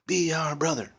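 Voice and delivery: very high squeaky chant; squeaky spiders. Acted. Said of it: "they squeaked".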